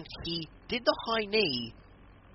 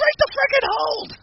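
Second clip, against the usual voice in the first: very high, loud scream; frantic shout; 11 dB louder than usual. Retold or imitated. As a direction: scream it like a panicked manager. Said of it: "Heyman's like".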